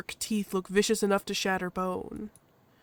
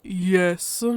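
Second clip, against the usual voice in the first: gruff husky Loken; 2 semitones lower, 5 dB louder than usual. Acted. Loken answers the badger, gruff and terse. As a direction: answer heavily, in one word.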